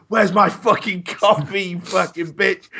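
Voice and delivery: high, slow, drawn-out outraged demand; monstrous diva rage. Retold or imitated. Spoilt presenter to his runner on day five, mock diva rage. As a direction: act a spoilt TV star losing it. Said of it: "the more of a monster you become".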